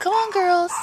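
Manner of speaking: high, loud call to coax hens. Real, not acted. Calling chickens again.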